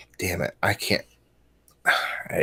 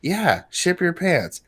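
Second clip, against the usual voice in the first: lady customer voice; 7 semitones higher than usual. Retold or imitated. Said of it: "the lady says".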